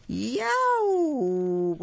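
long, high, loud wolf howl; drawn-out yelp of pain. A narrator's reading. Wolf with his tail on fire, howling. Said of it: "howled the big bad wolf".

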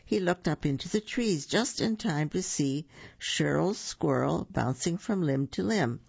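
ordinary delivery, nothing marked

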